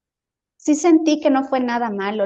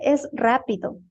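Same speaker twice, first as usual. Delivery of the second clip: ordinary delivery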